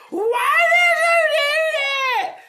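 very high, loud, slow wailing protest; hammed-up tearful scream; mock crying shriek. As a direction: wail it like sobbing in shock. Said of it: "was like".

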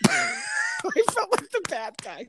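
helpless high laughter through the words; laughing. Real, not acted. Masseuse confessing she felt guilty, really laughing. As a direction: crack up while talking, slow and squealing. Cracks up at own story.

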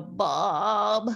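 congested call of mom; stuffy-nosed Mom. Retold or imitated. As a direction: call mom through a blocked nose. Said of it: "I would go".

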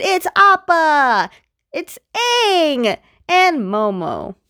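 high, drawn-out gleeful announcement; excited reveal. Retold or imitated. Storyteller revealing that friends have arrived in the sky, excited. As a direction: stretch each name out high with delight.

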